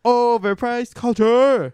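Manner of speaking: high, loud, slow game show title; announcer call. Pretend. Announcing the segment to his co-host, high and slow. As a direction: stretch it like a booming show announcer.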